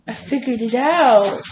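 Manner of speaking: high, drawn-out proud dog voice; pleased with herself. Retold or imitated. Voicing the dog who learned to open the door, pleased with herself. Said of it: "she is so pleased with herself".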